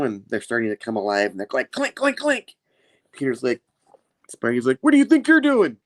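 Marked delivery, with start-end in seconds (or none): 1.7-2.6 high-pitched clinking imitation; metallic clinking. Imitates metal legs coming alive. 4.8-5.8 high, loud mock outrage; alarmed challenge. Spidey challenges the moving legs.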